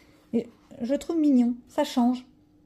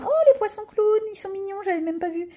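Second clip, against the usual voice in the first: delighted surprise; 9 semitones higher than usual. Real, not acted. Colorist spots cute clownfish, delighted. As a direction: burst out high at a cute discovery.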